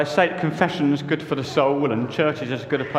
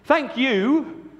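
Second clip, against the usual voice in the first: high, raised thank you; disciples' thanks; 8 semitones higher than usual. Acted. The men thank Mary in a raised voice. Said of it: "said the men".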